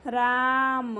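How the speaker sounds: drawn-out राम showing a pluta vowel; prolonged vowel. Shown as a demonstration. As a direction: stretch राम out, calling it long. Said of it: "जिसमें बहुत ज़्यादा time लगता है".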